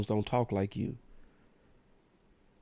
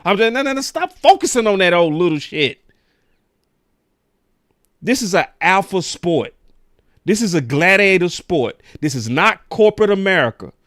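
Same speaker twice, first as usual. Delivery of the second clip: shouting, heated conviction; loud rant; 5 semitones higher, 9 dB louder than usual. Real, not acted. Host lectures critics on tough sports, shouting. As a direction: bellow it like a sports talk rant.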